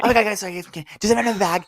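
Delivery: high, hurried flustered voice; flustered apology. Retold or imitated. To the silent guests, asking for a bag, lightly flustered. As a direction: play the flustered gift opener. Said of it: "and you're like".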